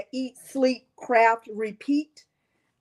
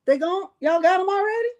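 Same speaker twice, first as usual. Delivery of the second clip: rising, louder, higher each time; can't believe they sold out; incredulous rising questions; surprised disbelief; 8 semitones higher, 3 dB louder, faster than usual. Real, not acted. Stunned that items sold out.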